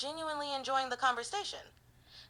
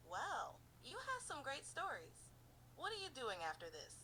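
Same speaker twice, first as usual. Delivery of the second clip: soft, playfully curious flirting; flirty; 3 semitones higher, 13 dB quieter than usual. Shown as a demonstration. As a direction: tease lightly and sound curious. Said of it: "a very fun and flirty tone of voice".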